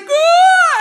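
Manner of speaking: high, loud startled scream; exterminator's shocked shriek, high and loud. Retold or imitated. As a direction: let out a sudden yelp of fright. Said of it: "I just hear him, like, yell".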